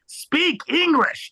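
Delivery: very high, stretched-out mock yell; hammed-up outraged demand, each word drawn out; mock shout. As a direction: imitate someone shouting at a foreigner.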